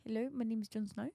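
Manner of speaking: put-on New Zealand accent. Shown as a demonstration. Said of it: "New Zealand, but do it properly".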